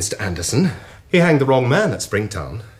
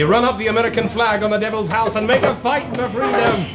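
laughing defiance; 7 semitones higher than usual. Acted.

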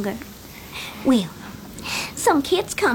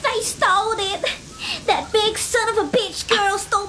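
crying; 7 semitones higher than usual. Acted. Child with bloody nose.

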